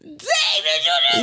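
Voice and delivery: high squealing shout, slow; laughing shout. Real, not acted. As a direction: shriek his name in disbelief. Friend jokes about crust.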